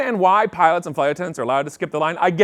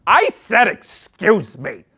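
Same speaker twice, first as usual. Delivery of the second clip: snippy flight attendant; 2 semitones higher, 3 dB louder, much slower than usual. Acted. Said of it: "she goes".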